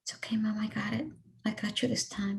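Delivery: a son quietly holding his mother up; gentle reassurance. Retold or imitated. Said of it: "my son picked me up and said".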